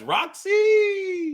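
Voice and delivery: high drawn-out cheer; high, long whoop; cheering shout. Real, not acted. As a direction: holler the name like a fan, stretching the end. Cheering the winner's name.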